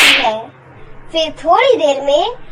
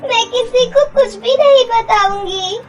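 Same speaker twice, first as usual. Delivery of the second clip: higher, teary begging voice; tearful pleading; 6 semitones higher than usual. Acted. Girl begging her stepmother not to throw her out, tearful pleading.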